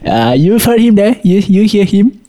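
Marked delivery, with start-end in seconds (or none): none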